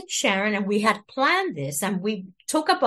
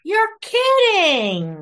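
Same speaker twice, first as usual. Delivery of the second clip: drawn-out, high amazed disbelief; surprised; 10 semitones higher, 5 dB louder, much slower than usual. Real, not acted. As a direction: stretch it out in real shock. Reacts to grandfather role news.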